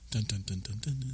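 soft, hushed "dun-dun-dun"; quiet mock-ominous duns; mock suspense sting. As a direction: voice a dramatic music cue under the breath. Mock suspense sting before the story turns.

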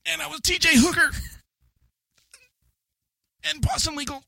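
high, pained wail between long pauses; William Shatner, dying sheep. Pretend. Dying actor recalling his TV roles, high mock-agonized voice. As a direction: list your credits as a dying ham. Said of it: "William Shatner, dying sheep, go".

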